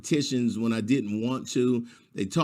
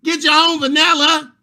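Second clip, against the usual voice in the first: high, loud outrage; indignant parent; 10 semitones higher, 6 dB louder than usual. Retold or imitated. Parent refusing to buy the teacher lotion, loud and high. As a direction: refuse it loudly, much higher. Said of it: "I'm like".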